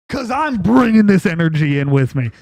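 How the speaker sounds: real loud threatening shout; loud rant. Real, not acted. Streamer warning Modern Warfare players, loud shout. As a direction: blast it out as a warning.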